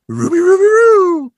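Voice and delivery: loud, very high Scooby-style howl; cartoon dog howl. [name] to the camera doing Scooby's call, full-volume howl. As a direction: belt out a cartoon dog's catchphrase.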